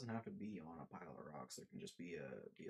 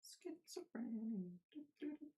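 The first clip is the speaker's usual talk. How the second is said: high silly singsong; 13 semitones higher than usual. Pretend.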